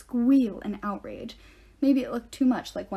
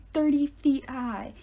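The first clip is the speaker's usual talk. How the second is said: ordinary delivery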